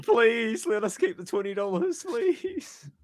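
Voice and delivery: high, pleading whine; mock begging. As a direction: beg like a desperate kid. Begging donor to keep money.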